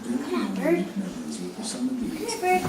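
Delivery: hushed, soft call to the bird; soft coaxing. Real, not acted. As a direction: coax it gently so it won't flee.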